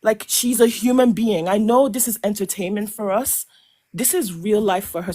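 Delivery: normal talking voice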